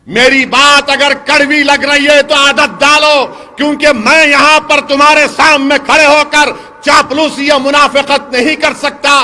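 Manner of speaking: ordinary talk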